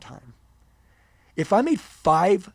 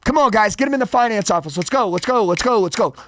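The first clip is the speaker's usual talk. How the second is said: retold manager's loud, high, urgent shout; 7 semitones higher, 7 dB louder than usual. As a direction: shout orders at the staff to get moving. Retold manager urgently ordering the staff.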